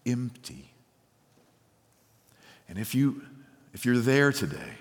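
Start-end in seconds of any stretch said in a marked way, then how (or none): 0.1-0.7 quiet confession. Pretend. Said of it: "Somebody here might say".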